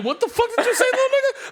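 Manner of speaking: put-on shocked dad cracking up at his kid; high laughing what did you say; incredulous outburst. Dad reacting to son's word.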